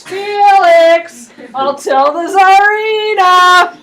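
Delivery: loud, drawn-out singsong yell; slow sung-out threat, loud. Retold or imitated. Rasputin running across the courtyard, yelling a singsong threat. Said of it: "he's yelling".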